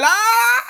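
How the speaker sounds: put-on sudden very high child's wail; imitated child's cry. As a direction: mimic a baby's sharp cry. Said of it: "the way the children will cry sometimes".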